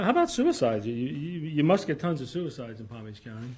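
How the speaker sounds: ordinary delivery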